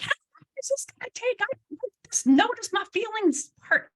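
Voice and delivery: high-pitched mimicked whine of complaint; mock exasperated client. Client fed up with a therapy exercise, mock exasperated. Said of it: "they get so mad at me".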